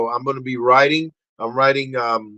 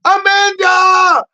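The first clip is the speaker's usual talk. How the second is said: long, high, loud call of "Amanda"; shouted name; 5 dB louder, slower than usual. Real, not acted. Host calling out to viewer Amanda, slow and loud. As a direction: stretch out her name in a shout.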